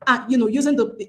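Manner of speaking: ordinary talk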